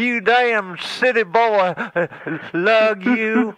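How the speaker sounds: put-on a crotchety old man who stammers on every word. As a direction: stutter and sputter like an angry old hillbilly. Stuttering insults.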